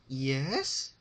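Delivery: bright chirpy yes; smiling Pinkie. Acted. Pinkie answers the terrified Rarity, cheerfully smiling. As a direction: answer brightly through a smile. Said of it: "said Pinkie with a smile".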